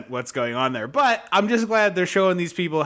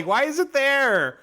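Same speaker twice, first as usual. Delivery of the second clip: exasperated; 9 semitones higher, slower than usual. Real, not acted. Ranting at the movie.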